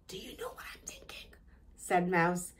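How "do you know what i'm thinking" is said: quick, hushed whisper; voiceless plotting whisper; conspiratorial whisper. A narrator's reading. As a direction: whisper it fast, like a secret plan. Secret plotting beside sleeping Grumpy.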